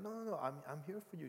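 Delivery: high-pitched, pleading reassurance; hurt protest. Retold or imitated. Uncle to his crying niece, hurt and pleading. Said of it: "I was really hurt".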